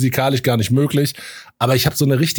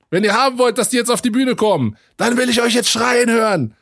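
hyping the crowd; 9 semitones higher than usual. Retold or imitated. Rapper hyping crowd on stage.

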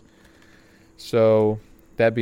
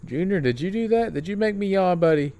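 higher, sing-song voice for the dog; playful pet talk; 8 semitones higher than usual. Real, not acted. Teasing his dog about yawning.

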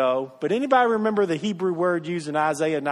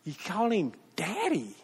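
tender daddy; 9 dB quieter than usual. Shown as a demonstration. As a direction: ease down to a near hush on daddy. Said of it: "you call dad".

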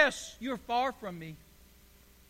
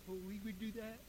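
mock pious; 2 semitones lower, 15 dB quieter, faster than usual. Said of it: "don't look at me so spiritual".

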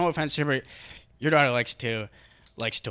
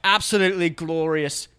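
British commentator; 3 semitones higher than usual. Pretend.

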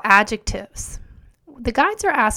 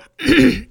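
short loud throat clear; rough cough-like throat clear; 3 semitones higher, 7 dB louder than usual. Real, not acted. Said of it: "excuse the throat clearing".

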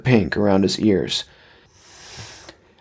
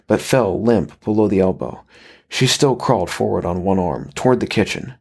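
plain reading voice

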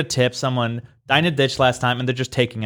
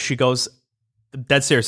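ordinary talk

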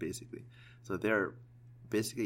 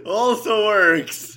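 high, loud, slow laughing words; 12 dB louder, much slower than usual. Real, not acted. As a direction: squeeze it out through laughter. Laughing hard after a joke.

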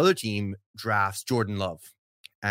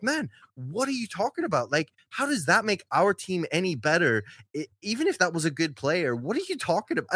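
incredulous; 6 semitones higher than usual. Retold or imitated. Own incredulous reply in group chat.

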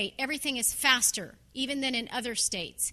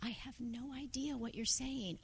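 much softer, puzzled inner voice; baffled under breath; 2 semitones lower, 13 dB quieter than usual. Retold or imitated. Listening to the fast Australian guy, muttered in confusion. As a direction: say it to yourself, lost. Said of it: "And I'm sitting here going".

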